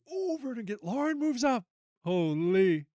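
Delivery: very high, excited exclamation; real amazement. Real, not acted. Host sees the leaderboard move, amazed. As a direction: start way up high in astonishment.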